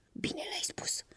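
hushed, pleased whisper; delighted whisper. A narrator's reading. Right slipper praising its mate, delighted whisper. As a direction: whisper your approval. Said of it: "șopti încântat pantoful drept".

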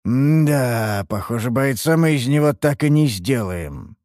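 ordinary delivery, nothing marked

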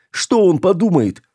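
high-pitched anxious wail; hysterical. A narrator's reading. Girl worries what the sailor will think, hysterical. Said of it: "зашлась в истерике Руди".

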